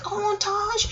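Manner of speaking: high, hushed Oh, Taj; impressed surprise. Retold or imitated. To her friend Taj who stood her ground, high awed hush. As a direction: breathe it out in awe, up high. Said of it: "I was like".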